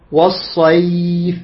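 والصيف with a long smooth "ay" glide; lengthened leen. Shown as a demonstration. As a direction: draw out the ي of والصيف before the f. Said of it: "لاحظ ياء ساكن".

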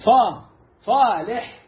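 صالح with a heavy emphatic ص, slowly; correct emphatic Ṣ. Shown as a demonstration. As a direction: drag out صالح with full emphatic Ṣ. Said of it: "اسمه صالح". (صاد مفخمة ببطء)

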